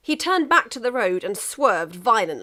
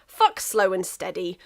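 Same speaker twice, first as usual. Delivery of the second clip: usual speaking voice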